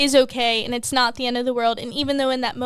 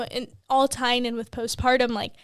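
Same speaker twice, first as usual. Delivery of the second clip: usual speaking voice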